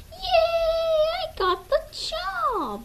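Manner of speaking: very high, slow, loud squeal of delight; high-pitched hammed-up cheer; squealed joy. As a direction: stretch it out in a thrilled shriek. Bird celebrating getting the job.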